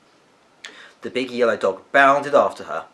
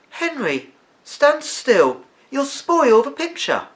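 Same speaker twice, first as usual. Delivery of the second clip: high, louder, slow mother voice; a mother telling her son to keep still; scolding mother; 6 dB louder, slower than usual. A narrator's reading. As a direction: tell the boy off gently but firmly.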